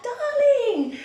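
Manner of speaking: high, loud desperate calls; calling out for wife. Acted. Husband calling his wife in the flood, desperate shouts. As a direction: cry out for her as she is swept away. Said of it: "into her".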